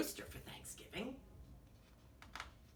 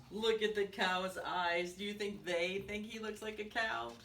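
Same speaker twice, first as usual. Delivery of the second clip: ordinary delivery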